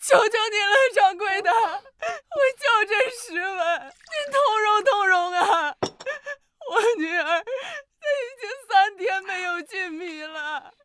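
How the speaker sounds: desperate crying plea; tearful begging. Acted. Begging mother with hungry daughter. (哭着苦苦哀求)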